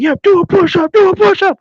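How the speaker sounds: high, shouted drill command; imitated sergeant yelling. Drill sergeants ordering push-ups, mock yelling. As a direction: bellow it like a drill instructor.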